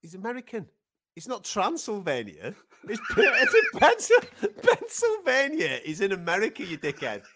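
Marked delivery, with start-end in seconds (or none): 2.9-5.8 high, drawn-out, incredulous laughing; laughing correction. Real, not acted. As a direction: struggle to say it slowly through laughter. Co-host laughingly corrects the location.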